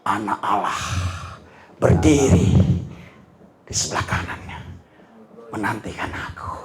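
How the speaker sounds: low, soft, reverent, breathed out; hushed awe. Retold or imitated. Preacher to congregation, voicing dying Stephen in a low hush. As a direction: speak it like a dying man's vision.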